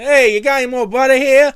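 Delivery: loud, high fake Italian accent. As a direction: shout for butter in a put-on Italian accent. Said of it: "You started talking in a fake Italian accent".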